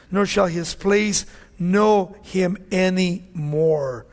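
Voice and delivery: slowing, weighty scripture read. Acted. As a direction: proclaim the verse from the pulpit. Reads Job passage aloud.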